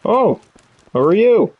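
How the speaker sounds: high, swooping surprised question; high-voiced, loud "who are you?"; acted surprise. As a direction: pitch it up high, as if meeting a stranger. Voicing game dialogue on meeting someone.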